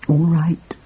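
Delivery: usual speaking voice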